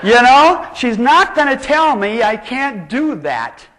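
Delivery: slow, loud victorious retort; triumphant defiance. Real, not acted. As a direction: throw it back at the teacher.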